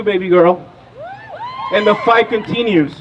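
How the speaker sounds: ordinary delivery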